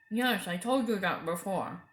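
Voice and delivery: talking through a mouthful; boy chewing sweets while answering; mouth full. A narrator's reading. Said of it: "with his mouth full of Turkish delight".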